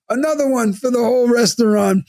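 high-as-a-kite call-out. Retold or imitated. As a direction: call it out to the whole room, hammed-up. Drunk on painkillers, banging the table.

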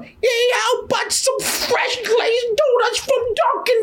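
high, slow goofy character voice; Whitey voice. Retold or imitated. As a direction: pitch way up and drag it out. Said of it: "or Whitey coming up".

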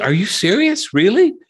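high-pitched disbelieving questions; incredulous. Retold or imitated. Writer hearing the comments were wrong, incredulous. Acting own reaction to surprising news.

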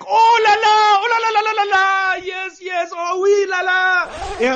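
Quebecois announcer. Retold or imitated. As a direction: scream it like an ecstatic Quebec commentator. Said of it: "Quebecois announcer".

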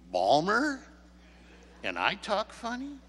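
high, rising disbelieving questions; incredulous comeback. Retold or imitated. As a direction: throw the accusation back as a question.

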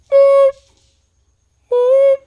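high, loud electronic beeps; scanner beep. Retold or imitated. Checker scanning his toilet paper, mock scanner beeps.

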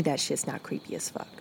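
whisper. Acted. Whispering about creepy face.

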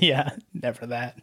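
laughing softly through it. Real, not acted. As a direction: chuckle while saying it. Repeated while laughing.

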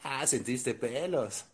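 burlón. Retold or imitated. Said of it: "Y te dice tu compa".

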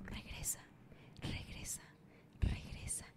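hushed whispering chant, very soft; eerie whisper. Acted. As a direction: whisper like a crowd of ghosts closing in. Los pasajeros susurran regresa. (susurro espectral muy bajo)

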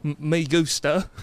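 slow, botched British take on Spanish; muddled mock-British accent speaking Spanish. Shown as a demonstration. Co-host mocking his friend's phony British, Spanish in a British accent. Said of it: "trying to speak Spanish in a British accent".

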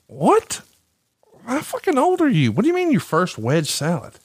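high, incredulous retold outburst; mock disbelief. Host retelling his reply to the salad confession, incredulous. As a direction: leap up in pitch as if astonished by a friend's confession.

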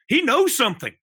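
high, loud breathless thrill; gripped excitement. Retold or imitated. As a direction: squeal it like you're hooked. Kids huddled, gripped by story.

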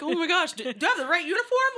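frantic, stumbling over the words; nervous rookie panicking before her first World Cup match; retold panic. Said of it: "I'm like".

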